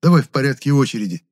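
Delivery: exasperated telling-off; indignant. A narrator's reading. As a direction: brush off an interruption crossly. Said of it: "возмутился я".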